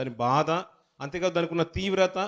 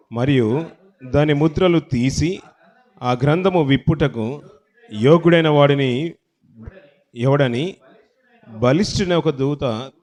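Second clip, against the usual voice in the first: loud, slow, measured Bible reading; scripture reading; 8 dB louder, slower than usual. Acted. Preacher reading Revelation aloud to the congregation, loud solemn recitation. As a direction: proclaim the verse phrase by phrase, loudly, with long pauses.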